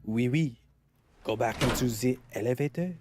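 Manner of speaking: put-on French accent; French-accented English, playfully put on; mock French accent. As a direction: say it like a hammed-up Frenchman. Mock French echo of the instruction.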